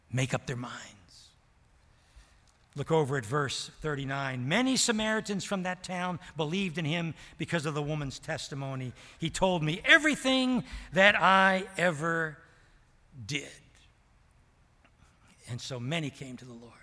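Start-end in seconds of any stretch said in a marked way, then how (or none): 4.5-13.5 loud, driving pulpit voice; full-force preaching. Acted. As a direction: preach it out to the whole church. Preaching reading scripture.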